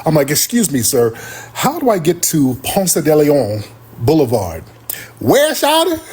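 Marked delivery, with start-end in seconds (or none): 0.3-4.6 overly proper speech. Retold or imitated. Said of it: "I'm being super articulate and I'm trying to correct people". 5.2-6.0 Atlanta local voice. Retold or imitated. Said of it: "learn Atlanta slang on the fly".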